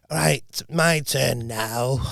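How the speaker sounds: slow, lightly voiced old lady; a hint of an old woman, slow. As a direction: drag it out like a hobbling old woman. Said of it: "she was like".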